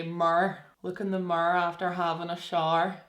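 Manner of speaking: imitated Belfast accent with squashed vowels. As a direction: mimic a Belfast local. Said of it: "they would say".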